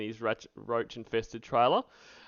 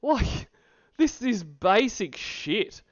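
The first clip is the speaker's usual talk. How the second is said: exasperated insult; 11 semitones higher, 4 dB louder than usual. Real, not acted. Yelling at the man on screen.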